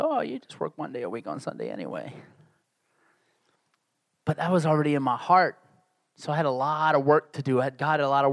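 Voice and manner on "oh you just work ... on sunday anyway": mocking quote. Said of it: "Some folks like to say".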